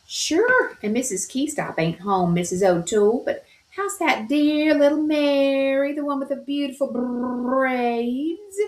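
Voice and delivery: high, lilting Irish maid; chatty maid with a lilt; Irish maid Nora. A narrator's reading. Maid Nora answers Mrs O'Toole on the phone, high and lilting.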